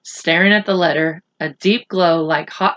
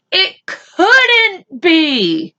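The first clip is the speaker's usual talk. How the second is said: very slow, high, near tears; a boy who can't believe it, voice cracking; choked disbelief; 9 semitones higher, 4 dB louder, much slower than usual. Acted. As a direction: let the words break.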